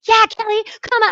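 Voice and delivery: put-on the kids shout back fired up; kids cheering back. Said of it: "and they were like".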